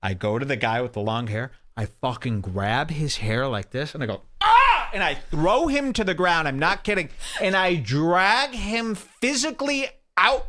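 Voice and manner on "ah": very high, loud attack scream; battle yell. Retold or imitated. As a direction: scream as you yank someone down. Acts out attack, grabbing hair.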